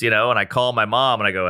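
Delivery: ordinary talk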